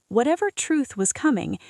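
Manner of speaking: usual speaking voice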